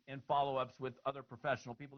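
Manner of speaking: ordinary talk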